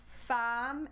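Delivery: FEMME drawn out as "fam"; correct pronunciation. Shown as a demonstration. Teacher demonstrates the sound change, femme as "fam". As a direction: hold the "ah" in femme. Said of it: "we change the sound with the sound A".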